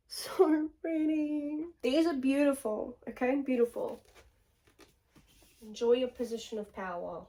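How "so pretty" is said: cooing. Real, not acted. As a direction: coo over the books. Slow cooing.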